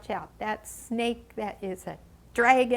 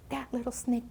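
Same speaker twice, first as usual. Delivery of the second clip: usual speaking voice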